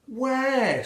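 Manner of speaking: high, loud cry; shouted question. A narrator's reading.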